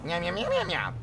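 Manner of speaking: soft mewing meows; quiet cartoon cat. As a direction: meow like a cat. Cat Nigel voiced.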